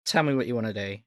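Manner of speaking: ordinary talk, nothing marked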